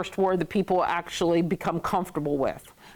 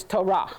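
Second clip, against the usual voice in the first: Torah as toh-RAH; stress on second syllable. Shown as a demonstration. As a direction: put the weight on RAH in Torah. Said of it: "get your accent on the second syllable".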